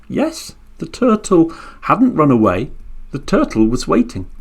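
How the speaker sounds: plain reading voice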